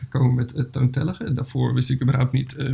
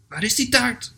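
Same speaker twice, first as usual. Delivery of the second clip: mild calling out; 9 semitones higher than usual. Acted. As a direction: call out lightly as the eager bear. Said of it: "riep de beer".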